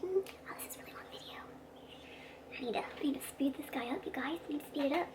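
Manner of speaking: soft, half-whispered muttering; hushed note to self; whispered aside. Real, not acted. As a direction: think aloud under your breath. Muttered outtake aside about the video.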